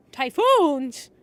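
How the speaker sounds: loud, startled "typhoons?" yelled out; shocked shout. Real, not acted. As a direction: blurt it out in disbelief.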